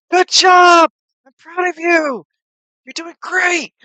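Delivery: very high, loud cheering shouts; yelling from the crowd. Acted. Kana in the audience cheers Lance on, high yell. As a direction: yell encouragement across an arena. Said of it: "someone distinctly in the audience yell".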